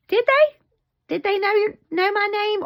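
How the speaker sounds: high, loud mock disbelief; incredulous questioning. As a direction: challenge it with the voice shooting up. Challenges mom's claim in retold talk.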